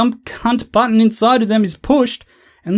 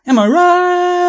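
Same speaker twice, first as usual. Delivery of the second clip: much higher, slow, hammed-up; high mock punchline; 10 semitones higher, slower than usual. Ranter crows over his own pun in a high voice. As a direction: squeak it out like a sitcom punchline.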